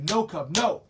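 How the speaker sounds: firm shout at cub. Retold or imitated. Guide shouting at a curious cub to back off, loud firm call. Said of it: "I have to say".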